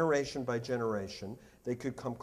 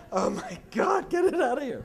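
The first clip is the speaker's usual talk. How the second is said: squealing mock disgust, voice much higher; mock horror; 11 semitones higher, 4 dB louder than usual. Cat person fleeing a drooling dog, hammed-up squeal. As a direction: shriek like a cat person recoiling from a needy dog.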